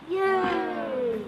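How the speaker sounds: 1 cheering. Real, not acted. Child cheers story end.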